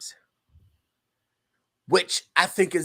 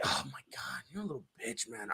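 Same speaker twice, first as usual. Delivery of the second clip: hushed aside behind someone's back; acted scornful whisper; mock whisper; 5 semitones lower, 16 dB quieter than usual. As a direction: whisper it in feigned disgust. Team talking behind player's back.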